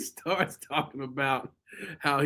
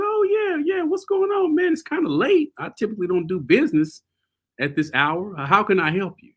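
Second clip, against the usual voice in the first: much higher, sing-song fake-friendly voice; mock polite high voice; 7 semitones higher than usual. To the late caller, sugary high polite voice. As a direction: put on a sweet customer-service voice.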